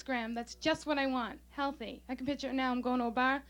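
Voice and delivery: ordinary delivery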